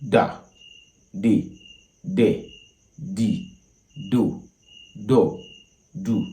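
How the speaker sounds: practicing tones: Da di de do on a steady low pitch; low tone syllables. Shown as a demonstration. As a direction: sing each syllable low and level. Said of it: "in the low form".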